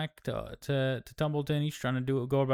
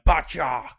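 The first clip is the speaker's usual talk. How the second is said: loud, sharp punch noise; mock punch sound effect; 4 semitones higher, 8 dB louder than usual. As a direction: smack the sound of a fist out.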